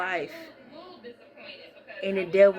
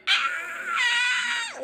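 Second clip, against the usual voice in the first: high shrieking mock scream. Imitating Pearlie screaming in the scene, high mock scream. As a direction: let out a shrill imitation scream. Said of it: "And then show daggone Pearlie".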